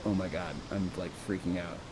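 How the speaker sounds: low, very soft aside; hushed freak-out. Acted. Miranda reacts to the match, low hushed aside. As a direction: mutter it low, almost to yourself.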